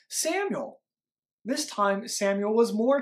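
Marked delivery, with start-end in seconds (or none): 0.1-0.8 high distant call of the name; voice calling again. A narrator's reading. God calls the sleeping boy again, high and far. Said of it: "the voice called again".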